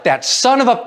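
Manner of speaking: hammed-up angry driver, breaking into a laugh; mock road rage. Driver cut off in traffic, mock furious. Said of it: "they get completely angry".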